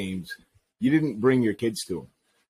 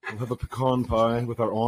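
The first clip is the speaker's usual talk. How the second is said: bougie voice. Pretend. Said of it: "I just feel very bougie saying aunt".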